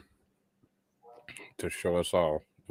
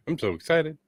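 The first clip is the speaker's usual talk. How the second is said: laughing; 10 semitones higher, 3 dB louder than usual. Real, not acted. Giggling about the sex bot.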